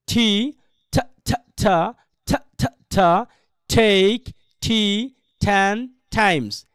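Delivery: very slow, each word drawn out separately. Shown as a demonstration. Teacher models a tongue twister for students, word by word. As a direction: say "take tea ten times" slowly with gaps between the words. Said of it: "প্রথমে পড়বেন এইভাবে".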